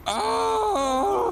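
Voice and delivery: crying out. Acted. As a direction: yell out in pain. Cop shot, crying out.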